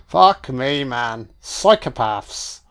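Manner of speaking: ordinary delivery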